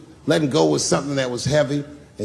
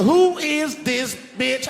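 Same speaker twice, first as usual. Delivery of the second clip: higher, slow drawn-out accusation; wife pressing him; high slow demand; slow accusing demand; 11 semitones higher, much slower than usual. Retold or imitated.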